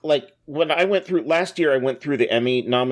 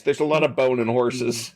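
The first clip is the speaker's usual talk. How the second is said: Buffalo accent; 3 semitones lower than usual. Shown as a demonstration. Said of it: "There's the official Buffalo stance".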